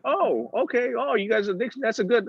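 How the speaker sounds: high-pitched, acted enthusiasm; high, polite mock recognition; clueless politeness. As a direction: play the impressed newcomer, pitched right up. Acts out his clueless first reaction.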